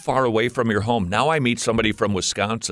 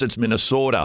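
Minnesota accent. Shown as a demonstration. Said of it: "Say Minnesota again".

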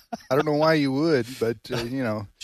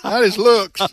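laughing; 8 semitones higher, 7 dB louder than usual. Pretend. Joking as wife, laughing.

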